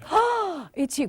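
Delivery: slow, laughing delighted greeting; joyful oh it's you. Shown as a demonstration. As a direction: gush over them. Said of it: "you're gonna say".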